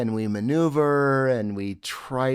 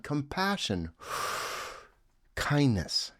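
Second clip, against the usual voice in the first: soft spoken word; 2 semitones higher, 5 dB quieter than usual. Shown as a demonstration. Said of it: "breath pause between the words".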